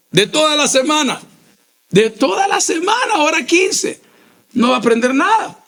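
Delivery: rising emphasis. Real, not acted.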